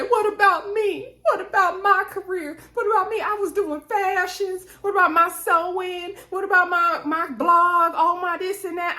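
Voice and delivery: high-pitched mock wailing complaint; imitated tearful outburst. Wife tells husband what she gave up, imitated tearful outburst. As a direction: act out a wife breaking down, pitched high throughout. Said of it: "she broke down in tears".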